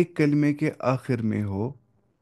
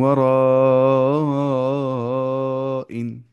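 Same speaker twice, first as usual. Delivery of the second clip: slow, drawn-out tajweed recitation; slow chanted recitation; Quran recitation; much slower than usual. Shown as a demonstration. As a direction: recite it slowly with nasal hold. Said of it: "फिर से".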